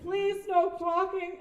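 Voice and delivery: loud and high, then a slow please no talking; a director scolding an extra for talking; mock director scolding.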